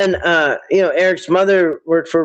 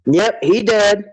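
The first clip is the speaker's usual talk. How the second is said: mock Betty; 4 semitones higher, slower than usual. Mimicking Betty's blunt reply.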